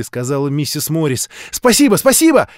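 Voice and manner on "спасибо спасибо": put-on high, loud child's cry of thanks; excited high yell; gleeful shout. A narrator's reading. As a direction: shout it like a delighted little girl. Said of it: "закричала мышка".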